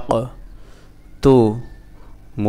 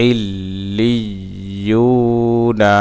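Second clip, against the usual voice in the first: "ইল্লিয়ুনা" in one slow flow, long u; joined reading pronunciation; much slower than usual. Shown as a demonstration. Teacher reading the word whole for learners, drawn out and joined. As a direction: connect "ইল্লিয়ুনা" and stretch the vowel. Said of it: "reading উচ্চারণ".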